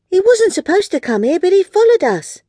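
put-on high apologetic little girl. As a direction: explain nervously. Girl explains to queen.

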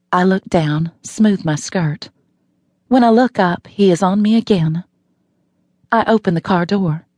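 ordinary delivery, nothing marked

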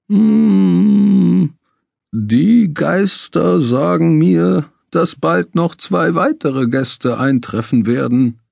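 high, very slow hollow ghostly intonation; sepulchral voice. Acted. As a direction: drag it out like a seance oracle. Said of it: "tönte er mit Grabesstimme".

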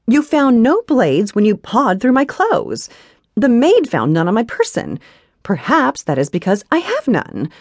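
put-on high, loud haughty young woman; defiant heroine. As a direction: needle him with lofty defiance. Heroine voiced in the story.

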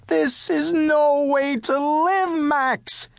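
high, drawn-out despairing complaint; exasperated wail. A narrator's reading. Cook complaining to his dog Max, exasperated. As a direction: wail it slowly, at your wits' end.

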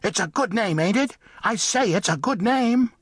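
high, insistent little mole voice; indignant mole. Acted. Mole defends his name to the cat, indignant.

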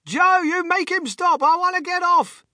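put-on very high, stretched-out old woman's yell; shrieked demand over the noise; panicked yell. A narrator's reading. Grandmother begging her husband to intervene, high panicked yell. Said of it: "yelled Grandma Josephine".

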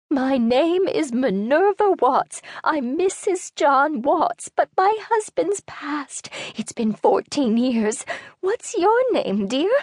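put-on high, slow, confused elderly voice; an old lady introducing herself to a stranger; rambling old woman. A narrator's reading.

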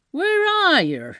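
very high, drawn-out demand; agitated tramp. Acted. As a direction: call out to someone you can't find. Tramp hunts for unseen speaker.

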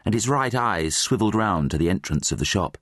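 plain reading voice

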